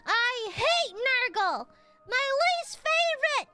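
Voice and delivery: high, loud, hammed-up shrieking; mock Nurgle rant. Streamer shrieking at Nurgle in hammed-up fury. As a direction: throw a squealing tantrum at the name.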